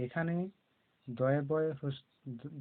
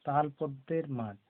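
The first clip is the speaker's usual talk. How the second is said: normal talking voice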